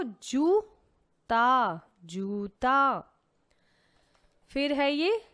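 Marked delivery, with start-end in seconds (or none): 0.0-1.8 very slow, stretched syllables; slowly stretched teaching voice; drawn-out word. Shown as a demonstration. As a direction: draw the word out for the children. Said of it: "तो क्या था वो?".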